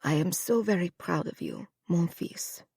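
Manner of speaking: soft, fading whisper of pride; a dying mother's hushed tenderness; tender farewell. Acted. Mother to her son at her deathbed, whispered.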